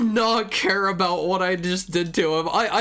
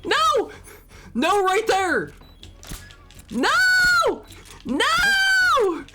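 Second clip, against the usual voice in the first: high shrieking no's; panicked screaming; slower than usual. Real, not acted. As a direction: scream no over and over.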